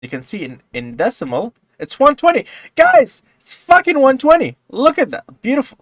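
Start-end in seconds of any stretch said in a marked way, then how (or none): 1.8-4.6 high, loud thrilled cry; excited shout. Real, not acted. Excited reveal of hex value. 4.7-5.8 high, loud admiring cry; excited. Real, not acted. Admiring the result.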